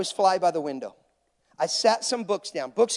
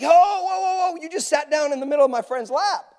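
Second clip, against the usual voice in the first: hammed-up indignant disbelief, pitched up; shocked protest; 7 semitones higher, 4 dB louder than usual. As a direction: cry out in comic alarm to halt someone. Acts out protest at seatmate sitting.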